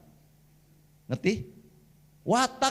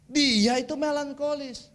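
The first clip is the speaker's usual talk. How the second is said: ordinary talk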